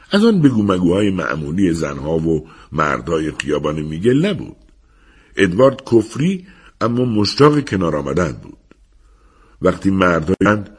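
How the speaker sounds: ordinary delivery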